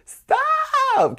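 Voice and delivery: very high, loud, drawn-out scream; long piercing shriek, mimicking a friend; imitated scream. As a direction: scream it to break them up. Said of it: "she starts screaming".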